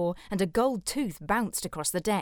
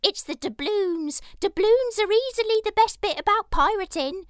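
pirate voice; 13 semitones higher, 6 dB louder than usual. A narrator's reading. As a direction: squawk it like a shrill buccaneer. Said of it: "said the albino pirate".

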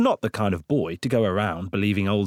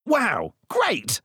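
put-on much higher, thrilled boy's "Wow, great"; delighted; 11 semitones higher than usual. Boy Blart thrilled by the threat of becoming a pig, delighted. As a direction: gush with childish glee.

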